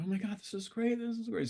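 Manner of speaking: very high mimicked excitement; gushing group praise. Imitating everybody in the circle praising the session, gushing. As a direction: imitate gushing admirers way up in pitch. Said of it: "So everybody's going".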